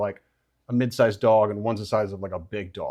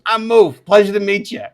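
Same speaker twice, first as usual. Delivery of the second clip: higher, louder creature introducing himself; chirpy pitched-up hello; Moof; 7 semitones higher, 8 dB louder than usual. Acted. Moof greeting Jerry in the sauna, higher and louder. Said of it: "he goes".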